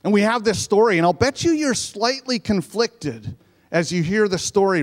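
ordinary talk

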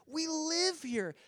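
soft, slow, high whoa of amazement; awed wonder. Retold or imitated. Looking at the ocean, soft awed wonder. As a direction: marvel quietly at the ocean. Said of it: "you're just like".

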